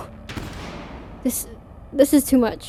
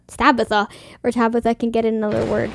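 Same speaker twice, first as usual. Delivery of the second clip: ordinary talk, nothing marked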